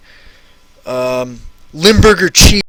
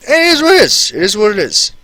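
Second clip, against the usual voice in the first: sing-song catchphrase; 6 semitones higher, faster than usual. Acted. Catchphrase after the anecdote.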